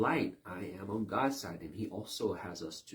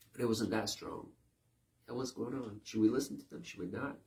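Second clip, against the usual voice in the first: ordinary talk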